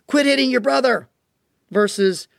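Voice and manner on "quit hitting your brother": high mock scold; a touch of an irritated parent's scold. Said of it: "Or another one".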